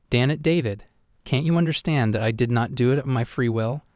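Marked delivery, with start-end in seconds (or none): none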